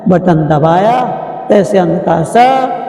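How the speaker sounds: ordinary talk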